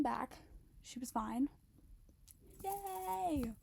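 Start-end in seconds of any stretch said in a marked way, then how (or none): 2.6-3.5 high sarcastic yay; mock cheer. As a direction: cheer it with irony. Sarcastic cheer after scare.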